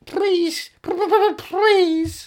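high, drawn-out begging plea; pleading high voice. Pretend. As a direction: whine it like a desperate child. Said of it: "go to them and say".

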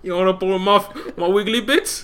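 high, loud comic British character; fake British accent. Co-host playing the flirty woman who insists it's real, fake British accent. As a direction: ham up a mock British accent. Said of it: "with a fake British accent".

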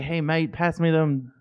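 put-on a casual amateur British accent; mock British mate. Guest shows how Brits talk, soft mock British. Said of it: "they say "mate" a lot".

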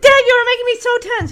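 put-on high, rushed teenage protest; imitated stressed daughter. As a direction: blurt it out like an exasperated daughter.

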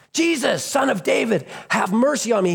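high, insistent acted call; tenacious crying out. Beggar who won't quit calls to Jesus, crying out. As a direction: keep calling out high, refusing to quit. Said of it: "but he won't quit. He's tenacious".

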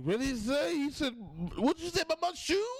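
very high-pitched, mock-indignant squawk; touchy drunk's outburst. Comedian mimics someone mad over a shoe, high mock outrage. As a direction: squeal it like someone taking offense at nothing. Retelling an angry, touchy drinker.

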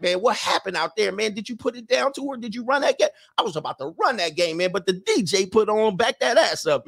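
light mock of a friend asking about the girl; homeboy teasing. Homeboys quiz him at party.

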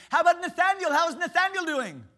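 very high, loud, bursting with excitement; excitable Brother Vic. Retold or imitated. Imitating Brother Vic on the phone, high and loud. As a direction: boom it out like the most excitable guy alive. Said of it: "He said".

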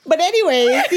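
high, loud laughing. Real, not acted. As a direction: squeal through laughter. Laughing at friend's accent.

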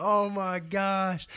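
high, drawn-out gasp of disbelief; imitating a stunned crowd; mock amazement. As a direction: stretch it out like the table just erupted. Whole table erupts at bet.

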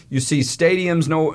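ordinary delivery

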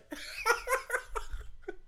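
airy squeal of laughter; breathless high laugh. Real, not acted. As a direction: cackle breathlessly. Laughing hard.

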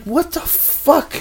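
slow high incredulous question; stunned disbelief. Retold or imitated. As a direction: stretch it out in disbelief. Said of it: "I'm like".

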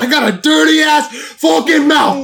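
high, loud mock shout of her rant; mocking angry yell. Mocking the woman who cussed out the clerk, a loud high yell. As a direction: screech it like the furious Walmart woman. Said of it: "She's all pissed".

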